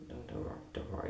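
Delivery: very soft, hushed "don't worry"; calm him down gently; the boss lady quietly reassuring him, imitated; soft reassurance. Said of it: "the other lady ... was going".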